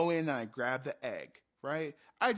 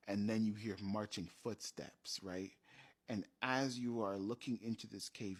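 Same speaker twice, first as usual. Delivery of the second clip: usual speaking voice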